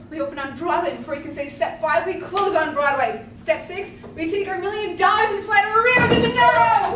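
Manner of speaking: scheming pitch swelling to a loud, high cry; building excitement. Acted. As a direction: build step by step to triumph. Schemer builds to escape to Rio.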